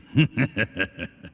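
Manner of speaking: knowing chuckle in the throat. Acted.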